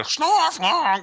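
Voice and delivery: high-pitched crazy cartoon noise; Pinky. Host mimicking a cartoon's crazy sounds, high and zany. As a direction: yelp it like a zany cartoon sidekick. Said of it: "you hear Pinky go".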